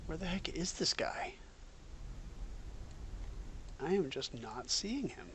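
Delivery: ordinary talk, nothing marked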